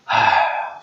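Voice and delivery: imitated sigh of giving in; mock reluctant sigh; retold sigh. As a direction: sigh as if grudgingly agreeing. Acting out reluctant man's reply.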